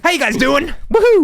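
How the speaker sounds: high, loud hype cheer; excited greeting. Real, not acted. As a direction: whoop it up for the crowd.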